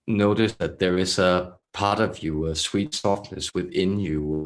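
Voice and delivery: measured, throaty channeler with long pauses; throaty channeled voice. Acted. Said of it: "they speak in such a throaty".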